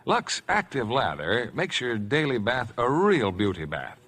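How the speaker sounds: ordinary talk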